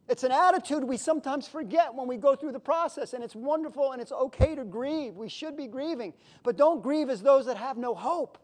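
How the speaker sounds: ordinary delivery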